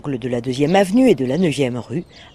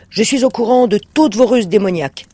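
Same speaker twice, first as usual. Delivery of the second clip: colère contenue; 6 semitones higher, 4 dB louder than usual. A narrator's reading. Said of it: "essayant de garder son calme".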